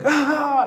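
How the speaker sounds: high imitated wail; mock loud crying. Preacher imitating the convert crying at night, mock wail. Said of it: "like crying out loud".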